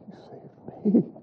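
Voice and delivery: slow awed whisper. Retold or imitated. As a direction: whisper it in wonder. Paul's whispered personal wonder.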